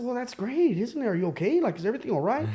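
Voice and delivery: half-pleased, half-worried; puzzled relief. Retold or imitated. To girlfriend who is crying over the birth, puzzled. Reacting to birth news on phone.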